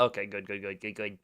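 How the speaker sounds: very soft, low mutter; hushed, repetitive self-reassurance; muttered aside. Real, not acted. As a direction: mumble it low to yourself.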